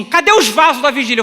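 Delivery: loud, high-pitched vigil leader; rally the congregation in mock zeal; rousing call. Vigil leader at microphone calling the faithful, loud and rousing. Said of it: "pegou o microfone e falou assim".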